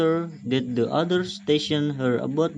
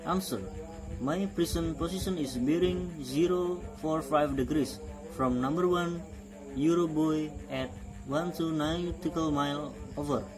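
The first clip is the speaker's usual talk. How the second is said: calm radio operator call; marine radio; 9 dB quieter than usual. Shown as a demonstration. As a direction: read it like a VHF radio exchange. Radio call demo dialogue.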